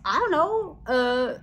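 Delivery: high, loud, clueless guessing; imitated bewilderment. Husband trying to read the test, in imitated bewilderment. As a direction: play a baffled husband fumbling for the answer.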